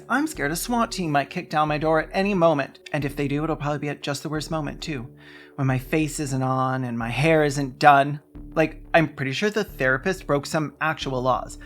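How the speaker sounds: ordinary talk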